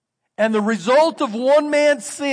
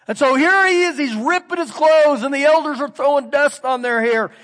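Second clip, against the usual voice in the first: raised, driving sermon voice; preaching at full force; 4 semitones higher, 4 dB louder than usual. Acted. Preacher to congregation, telling Joshua's grief with fervor. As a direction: build it up for the congregation.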